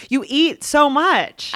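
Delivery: high, slow and emphatic; exaggerated complaint. Real, not acted. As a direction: stretch it out in disbelief. Complaining about food.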